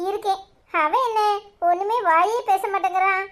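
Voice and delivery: usual speaking voice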